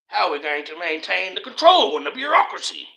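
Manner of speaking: mock billionaire fearing loss of control; fretting elite. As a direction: play a scheming official aghast. Mock elite worrying about control.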